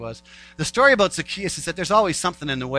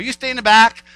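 dismissive crowd; 6 semitones higher, 9 dB louder than usual. Retold or imitated. Crowd voiced shutting out Zacchaeus.

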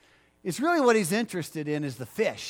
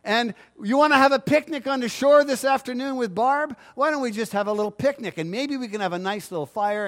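complacent believer; 5 semitones higher, 4 dB louder than usual. Retold or imitated. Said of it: "And we go".

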